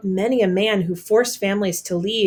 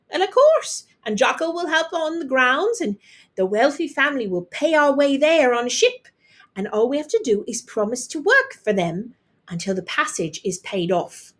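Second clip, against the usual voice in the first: neighbour woman; 6 semitones higher than usual. A narrator's reading. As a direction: brag over the back fence. Neighbour voiced.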